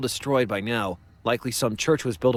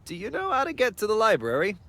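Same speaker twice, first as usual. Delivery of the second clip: polite lost tourist; 9 semitones higher than usual. Acted.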